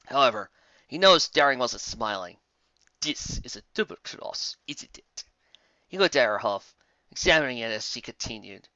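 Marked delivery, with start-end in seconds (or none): 3.0-5.2 hushed, breathless accusation; villain whispering a suspicion; suspicious whisper. Acted. Villain suspects betrayal.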